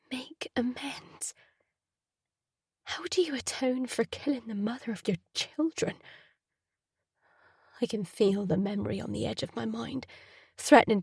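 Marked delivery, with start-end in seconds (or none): 0.1-6.0 whispered thought. A narrator's reading. Patrick's private bitter disbelief, whispered. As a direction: breathe it in disbelief.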